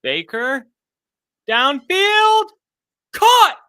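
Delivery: building to a high, loud shout; excited play-by-play. Real, not acted. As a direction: call the throw, explode on the catch. Live call of long pass.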